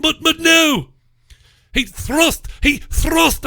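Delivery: high-pitched, indignant protest; mock outrage. Mock outrage at clipboard incident.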